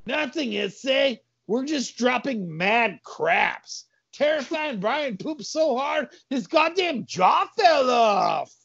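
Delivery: high put-on voice, slowing down; high-pitched excuse; story character covering up.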